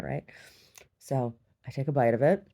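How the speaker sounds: usual speaking voice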